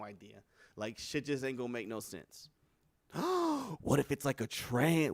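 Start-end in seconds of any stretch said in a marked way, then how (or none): 3.1-3.8 high, sudden gasp; big gasp of discovery; mock shocked gasp. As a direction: gasp at a scandalous idea. Struck by a sudden idea.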